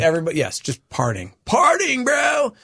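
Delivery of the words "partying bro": mock party cheer.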